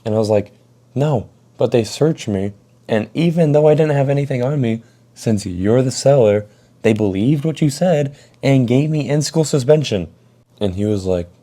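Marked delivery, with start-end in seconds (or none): 0.9-10.1 raised, higher and indignant retelling; exasperated protest. Retold or imitated. [name] arguing with Jackson, raised exasperated voice. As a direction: [name] protesting to the friend who lied about him, heated and high. [name] snapping at his friend.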